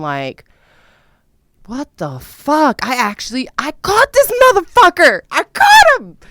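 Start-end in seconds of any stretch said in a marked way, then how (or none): 1.6-6.2 high, loud shocked outburst, rising to a shriek; shocked discovery. Retold or imitated. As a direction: shriek it out like you just caught him red-handed. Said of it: "I'm thinking".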